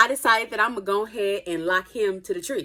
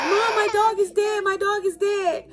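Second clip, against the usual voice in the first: much higher, shouted news to mom; dramatic kid wail; 12 semitones higher than usual. Retold or imitated. Boy running to his mom, much higher mock-panicked shout. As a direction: run in calling it out, overdramatic. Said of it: "and I'm like".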